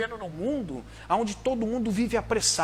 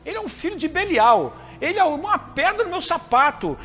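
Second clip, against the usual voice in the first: higher, louder grumbling complaint; mock indignant rant; 5 semitones higher, 3 dB louder than usual. Preacher voicing a hypocrite who hates his brother, ranting higher and louder.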